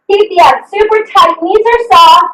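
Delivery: ordinary delivery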